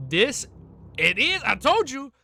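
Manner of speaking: loud, high burst of vindication; triumphant excitement. Real, not acted. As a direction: shout it out, thrilled to be proven right. Reader vindicated by plot reveal.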